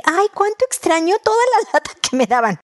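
high, sighing mock-sentimental mother; a fond, high, mock-nostalgic sigh; wistful nostalgic mom. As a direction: play the mom who suddenly misses the chaos. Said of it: "vas a decir".

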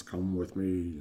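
low, quiet summons from Death; lightly voiced Grim Reaper; Death figure. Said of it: "Death comes in and".

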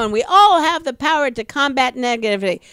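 sarcastic, quick self-help guru delivery; mock motivational voice. Mocking the voice in her head narrating on stage, sing-song sarcasm. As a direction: parody a cheesy inspirational speaker.

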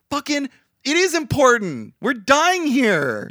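high, loud exasperation; exasperated outburst. Real, not acted. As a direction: burst out in disbelief. Outraged rant.

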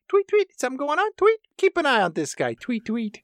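tiny high-pitched bird chatter; comic talking-bird voice; chirping birds. Acted. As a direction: tweet like a suspicious little bird. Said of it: "The birds chirp for just a little bit".

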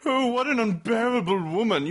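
a hint of a haughty English lady looking down her nose; mock-posh disdain, lightly done; snooty British sneer. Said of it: "snooty British people like".

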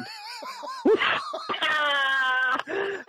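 real high-pitched laughing fit; helpless high laughter; burst of laughter. Real, not acted. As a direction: crack up hard at the punchline, pitch soaring. Host breaks up at punchline.

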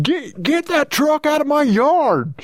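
high, slow Missouri twang. Pretend. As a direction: drawl it in a Missouri accent. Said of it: "You wanna take us out with one?".

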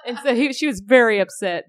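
laughing through the words; can barely get it out laughing. Real, not acted. Laughing at the memory.

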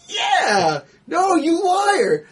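high yeah into drawn-out high cry; mock accusing exchange. Retelling Rocket catching Groot out, high-pitched mock outrage. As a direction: act out a squeaky fib, then cry liar.

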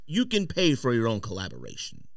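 usual speaking voice

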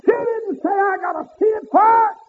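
high ringing preacher's cry; preaching at full force. Acted. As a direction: lift it up and ring it out to the congregation. Preacher whooping.

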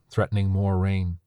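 plain reading voice

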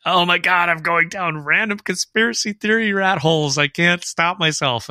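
high, excited rush of panic, slowing at the end; frantic listener quote. Retold or imitated. Reading a listener's email aloud in her frantic high voice. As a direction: play the listener spiralling down conspiracy rabbit holes. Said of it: "who wrote in where she's like".

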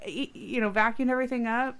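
ordinary delivery

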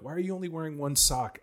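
lightly hammed-up frustrated father; mock exasperated dad. Dad voicing exasperated self.

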